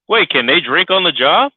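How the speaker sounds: ordinary delivery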